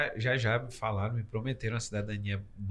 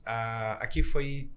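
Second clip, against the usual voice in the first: usual speaking voice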